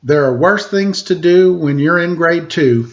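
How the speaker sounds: lilting rhymed read-aloud voice; story narration. Acted. Host reading a rhyming picture book to kids, lilting narration. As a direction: read the verse to the children with bounce.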